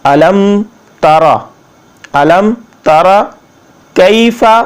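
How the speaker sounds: careful word-by-word tajweed; teacher modelling Arabic recitation; Quranic recitation lesson. Shown as a demonstration. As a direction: recite each word separately with held vowels.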